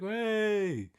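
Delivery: high cheery shout of hey; happy country boy hollering hey; calling out. Retold or imitated. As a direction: yell hey across the street to a pal. Calling out to Rizzo from car.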